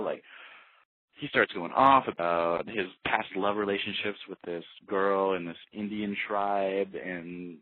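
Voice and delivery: usual speaking voice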